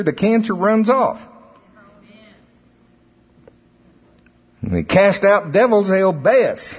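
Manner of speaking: rising fervent preacher's cry; preaching. Acted. Preacher exhorts congregation to rebuke cancer, fervent preaching. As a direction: drive the message home to the church.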